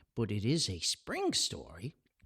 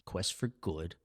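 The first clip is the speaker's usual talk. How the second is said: ordinary delivery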